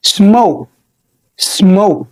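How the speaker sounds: "smoke" with a long ow vowel; model pronunciation. Shown as a demonstration. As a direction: say "smoke" slowly for repetition. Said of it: "انطق انت الكلمة دي". (نطق smoke بوضوح)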